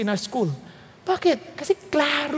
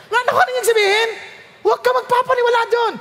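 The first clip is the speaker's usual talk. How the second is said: excited warning; 10 semitones higher, faster than usual. Real, not acted. Warning congregation about the drinker's promises.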